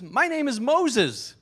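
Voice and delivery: high, loud, drawn-out parrot reply. Acted. Parrot introducing itself to the burglar, high, loud and slow. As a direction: announce it slowly in a high bird voice. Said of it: "parrot says".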